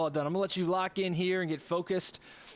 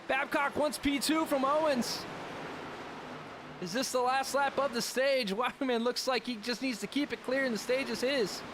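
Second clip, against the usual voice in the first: brisk, raised play-by-play call; live race play-by-play; 7 semitones higher than usual. Acted. Streamer calls the race lap for viewers, lively play-by-play.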